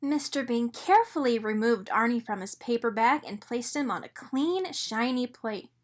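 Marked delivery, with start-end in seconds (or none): none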